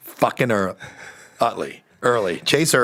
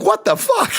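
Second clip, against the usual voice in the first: stunned disbelief. Retold or imitated. Brother-in-law stunned on phone.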